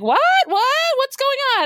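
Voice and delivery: high, feigned innocent whine; imitating the thief feigning confusion; mock clueless. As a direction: play dumb, high and puzzled. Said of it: "he's like".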